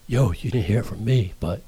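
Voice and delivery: hushed gossip whisper; secretive low whisper; dropped voice. Shown as a demonstration. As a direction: drop the volume right down. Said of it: "you drop twenty decibels off your voice".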